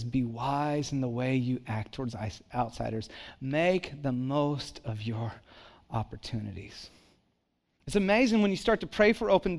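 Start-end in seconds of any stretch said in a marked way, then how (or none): 0.0-3.1 softer, offhand quote of the verse; quiet scripture quote. Shown as a demonstration. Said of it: "and it says". 3.4-6.9 slowed right down and softer; slow scripture quote. Shown as a demonstration. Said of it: "and it says".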